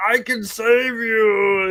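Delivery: high, drawn-out rescue call; hammy slow-motion hero shout; mock heroic cry. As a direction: call out desperately, overacted. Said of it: "Perrin's gonna be like".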